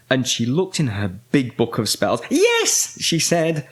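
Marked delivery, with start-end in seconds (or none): 2.3-3.0 very high, excited yes; triumphant discovery. A narrator's reading. Winnie finds it in her spell book, high triumphant cry. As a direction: cry it high as the answer appears.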